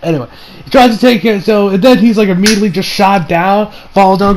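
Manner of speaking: ordinary talk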